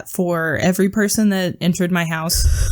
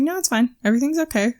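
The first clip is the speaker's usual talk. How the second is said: higher-pitched patient placating; forced reassurance; 6 semitones higher, faster than usual. Retold or imitated. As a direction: insist lightly that all is well. Imitating his forced patience.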